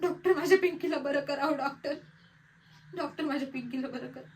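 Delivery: soft plea that fades away; helpless, hushed begging; pleading. Acted. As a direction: beg the doctor, voice sinking very low. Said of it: "डॉक्टरांना विनवणी केली".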